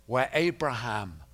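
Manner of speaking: ordinary delivery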